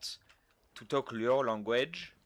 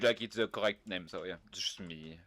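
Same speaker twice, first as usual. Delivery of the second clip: ordinary delivery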